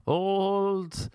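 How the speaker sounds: high, drawn-out hype introduction; mock show announcer. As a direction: announce yourself to an imaginary cheering crowd. Hyped mock introduction of himself.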